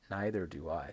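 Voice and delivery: "Neither do I" low, sliding down; falling "Neither do I". Shown as a demonstration. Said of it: "Can you hear that goes down?".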